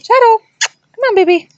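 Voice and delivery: very high coaxing pet voice. Real, not acted. Owner coaxing her dog Shadow, very high sweet pet voice. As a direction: coo her over in a squeaky high voice. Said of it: "I don't think you guys ever seen Shadow".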